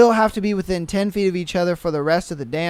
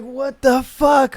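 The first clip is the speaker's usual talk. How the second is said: ordinary delivery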